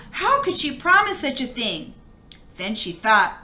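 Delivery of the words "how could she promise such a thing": a higher, shocked question; aghast. Acted. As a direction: sound appalled at the promise. Narrator aghast.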